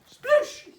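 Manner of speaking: high, loud imitated splash; splash noise. Duck diving straight into the pond, high explosive splash. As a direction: burst it out as the sound of a dive.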